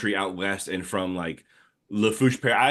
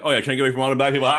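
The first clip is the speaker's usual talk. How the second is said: cackling jab; 5 semitones higher, 5 dB louder, much faster than usual. Retold or imitated. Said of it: "to be like".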